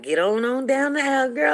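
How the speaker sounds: high, loud imitated holler; urging shout. Friend hurrying the bride down the aisle, urging shout. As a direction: holler it high, as an acted order.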